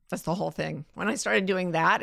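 ordinary talk

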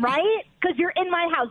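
ordinary talk, nothing marked

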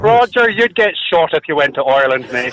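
put-on Irish brogue, lifting on the name; Irish accent. Contestant ribbing Roger's attempt, Irish accent. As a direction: tease in a broad Irish lilt. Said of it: "your turn to be Irish, buddy".

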